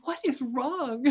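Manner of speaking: high, slow alarmed question; acted concern. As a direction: ask like a worried friend.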